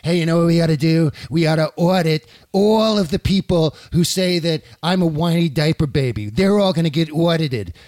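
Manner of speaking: lightly voiced president giving orders; Trump on the phone. President ordering the tax agency to audit critics, lightly voiced. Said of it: "if he picks up the phone to the Internal Revenue Service and says".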